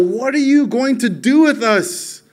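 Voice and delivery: high, loud mock outcry, drawn out; demon crying out. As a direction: cry out slowly as the demon, pitch lifted. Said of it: "this demon cries out".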